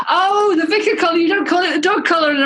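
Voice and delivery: high, loud laughing realization. Real, not acted. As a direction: laugh as it dawns on you.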